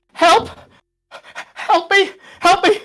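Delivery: high, loud frantic cries for help; panic attack. Acted. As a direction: repeat it in sharp desperate bursts. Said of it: "goes into a panic attack".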